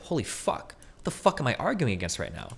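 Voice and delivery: breathy, hushed dawning disbelief; hushed realization. Retold or imitated. Debater realizing mid-argument, hushed disbelief. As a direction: whisper it as it dawns on you.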